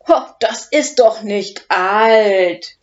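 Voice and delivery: loud, slow booming dragon voice. Acted. Big eating dragon Udo booms down at the old professor. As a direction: boom it out, amused and hungry. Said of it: "dröhnt Udo".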